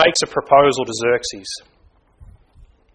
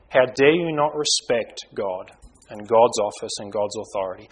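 without anger; 3 semitones lower than usual. Shown as a demonstration. Father correcting his child on God's behalf, calm sober reproof. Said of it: "hard to say that with an angry voice".

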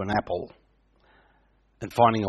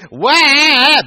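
very high, loud imitated baby wail; baby crying; 12 semitones higher, 7 dB louder than usual. Preacher mimics a lonely baby faking tears, loud wail. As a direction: let out a piercing infant wail. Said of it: "Baby starts crying".

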